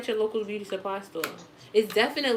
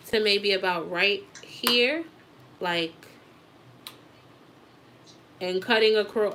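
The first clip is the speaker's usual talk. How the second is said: usual speaking voice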